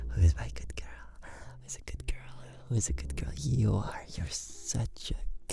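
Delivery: tender whispered praise; whispering. Acted. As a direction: whisper it close and soft.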